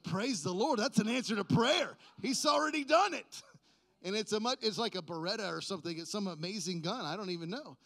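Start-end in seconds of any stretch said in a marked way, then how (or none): 0.0-3.4 rising, louder mock praise; hammed-up joy, climbing higher; excited praise. Pastor thanking God for the gun, mock excited. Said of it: "I was like".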